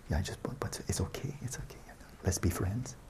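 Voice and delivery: unvoiced whisper, placating; mock meek whisper. A meditator cooing to his wandering mind, in a mock whisper. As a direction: soothe the rambling mind in a hushed whisper.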